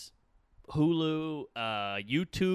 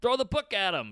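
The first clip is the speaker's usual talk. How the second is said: high, louder dismissive outburst; mock indignation; 8 semitones higher, 5 dB louder than usual. Outraged crowd wanting a comic punished, mock indignant. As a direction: play the outraged accuser, mildly hammed-up.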